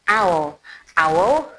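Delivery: normal talking voice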